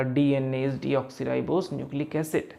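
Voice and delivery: very slow, every syllable spelled out; slow dictation. Shown as a demonstration. Teacher reading aloud a fact to students, very slowly. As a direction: read it out word by word like dictation. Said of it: "मैंने बोला".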